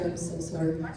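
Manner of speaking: normal talking voice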